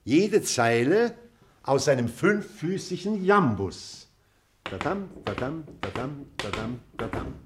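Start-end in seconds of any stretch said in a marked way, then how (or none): 4.6-7.3 softly chanted "dadam", stress on each DAM; iambic metre demo. Shown as a demonstration. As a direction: beat out five soft "dadam" feet, second syllable stressed. Demonstrates the five-foot iambus metre.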